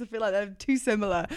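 ordinary talk

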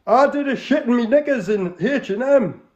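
put-on Geordie accent. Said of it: "an authentic, uh, Northerner".

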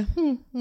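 skeptical hmm. Retold or imitated. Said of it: "It was very much a".

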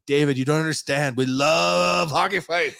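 high, stretched-out enthusiastic plea; fervent pleading. Acted. Buyer persuading the owner of his devotion, fervent high plea. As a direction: gush to convince him.

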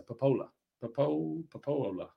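trying out Popola softly, stretching the middle "oh"; practising pronunciation. Shown as a demonstration. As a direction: rehearse Popola quietly, breaking off at Popo- and retrying. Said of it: "to do that better".

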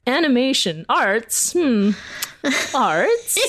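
high, doubtful mock parent voice; skeptical parents. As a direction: play the baffled parent questioning arts. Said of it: "they're like".

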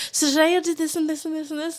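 high, rapid-fire vlogger chatter; vlogging voice. Retold or imitated. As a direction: rattle it off high like a fast vlog recap. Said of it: "when I'm doing my vlogs and I'm like".

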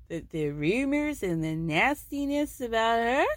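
mock Eastern European accent imitation; odd foreign accent. Meredith threatening Lisa in a put-on accent. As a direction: drift into a vague foreign accent. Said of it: "journey of accents".

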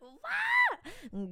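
high startled yelp; surprised shout. Real, not acted. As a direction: jump at the question. Shocked by karaoke question.

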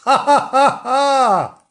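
loud, high "ha ha ha"; hammed-up storybook laugh; high staged laugh. Gillian laughing at the puzzled dogs, high staged laugh. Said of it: "with a laugh".

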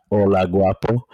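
lightly imitating his deep greeting. Imitating the crush greeting the storyteller before a kiss, deep voice. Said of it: "again, as he always does".

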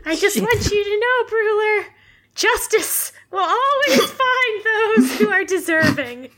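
high, theatrical, laughing-crying speech; grand tragic send-off; dramatic villain farewell. Acted. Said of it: "she'll say".

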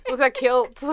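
loud, high, half laughing; laughing through it. Retold or imitated. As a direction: laugh at the picture they expected. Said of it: "they were like".

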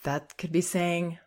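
ordinary talk

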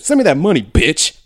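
barked street demand; loud demand. Retold or imitated. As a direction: send me that money, bitch. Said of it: "He said".

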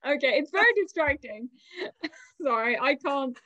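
talking through giggles; can't stop laughing. Real, not acted. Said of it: "The sight of people laughing just sets me off".

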